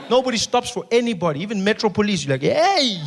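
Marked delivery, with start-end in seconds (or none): 2.4-3.0 call out. Acted. Calling Metro Police.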